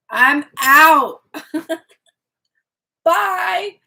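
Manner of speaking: loud, high, laughing exit; mock storm-off. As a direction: shout it as a hammed-up goodbye.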